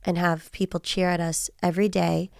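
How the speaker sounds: ordinary talk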